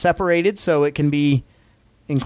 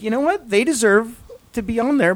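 high put-on beer lover voice; lightly voiced beer drinking dad; 7 semitones higher than usual. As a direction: voice a beer-loving dad, pitched up. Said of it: "What voice was that?".

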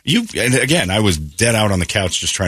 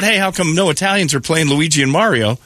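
mock scream; 7 semitones higher than usual. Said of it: "nobody screamed out".

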